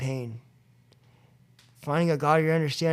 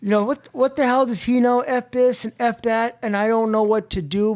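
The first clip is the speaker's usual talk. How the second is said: higher and louder, seething; angry rant; 6 semitones higher, 6 dB louder than usual. Retold or imitated. Driving away from his brother, ranting louder and higher. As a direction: vent it, working yourself up.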